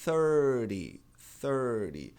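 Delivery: "thirty" with a tapped T, like "thirdy"; "thirty" the American way, the T flapped. Shown as a demonstration. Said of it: "trinta é".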